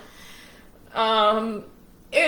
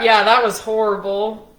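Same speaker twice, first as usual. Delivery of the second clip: ordinary talk, nothing marked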